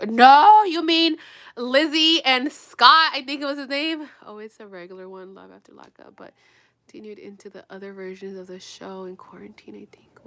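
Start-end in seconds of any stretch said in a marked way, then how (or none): 4.4-10.2 a hushed, unvoiced aside; whisper. Real, not acted. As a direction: whisper it, half to yourself. Unvoiced aside, low level.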